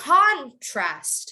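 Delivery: "contrast" with a big CON, then a drop; drawn-out demo, CON-trast for "contrast"; stress on first syllable. Shown as a demonstration. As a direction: hit the start of "contrast". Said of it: "as a noun, you would say".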